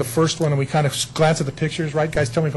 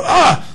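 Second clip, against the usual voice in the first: exasperated cry; 12 semitones higher, 8 dB louder than usual. Retold or imitated. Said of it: "And I go".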